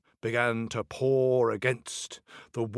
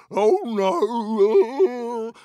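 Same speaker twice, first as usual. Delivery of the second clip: high, loud cartoon wail; storybook troll's drawn-out cry of dismay; alarmed troll wail; 10 semitones higher, 6 dB louder than usual. A narrator's reading. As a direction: wail as if flung into the air. Troll cries out as he flies.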